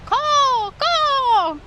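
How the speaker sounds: high, drawn-out falling caw; loud high seagull-style cry; bird call. Shown as a demonstration. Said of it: "keep yelling his name".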